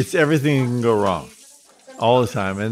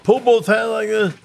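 high-pitched imitation of a called-out command; urgent instruction; 10 semitones higher than usual. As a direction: call it up high, as the instructor guiding a landing. Jump master urgently instructing before landing.

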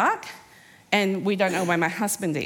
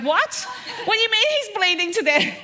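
incredulous shock; 11 semitones higher than usual. Retold or imitated. Mum hears her son is bleeding to death, shocked laughing disbelief. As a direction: react like you can't believe your ears. Mother hears alarming news.

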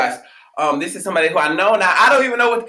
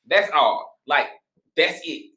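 normal talking voice

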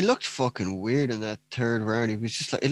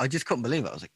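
usual speaking voice

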